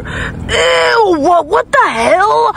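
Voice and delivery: real shout of disgust. Real, not acted. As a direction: shriek at the dirty car. Finds dirty fingerprints on car.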